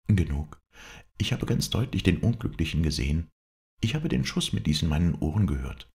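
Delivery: wife insisting on what she witnessed; insistent wife. Acted. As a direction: insist on it in a high voice.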